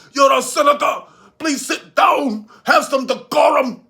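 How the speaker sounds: stern, loud mock reprimand; scolding chairman. Bernie Sanders scolding Mullen to sit down, mock stern. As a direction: lecture him like an old chair. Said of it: "Talking about".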